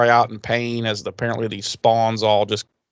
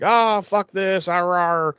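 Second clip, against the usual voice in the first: a hint of a fed-up beast roaring; lightly voiced monster grumble; 6 semitones higher than usual. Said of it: "the Hacksaw Beast is like".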